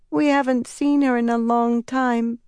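put-on high, frail little boy; a sick child, barely able to speak; weak child voice. A narrator's reading. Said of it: "the child said in a weak voice".

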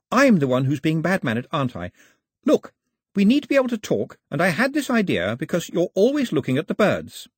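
lightly voiced eager young girl; Daphne explaining. A narrator's reading.

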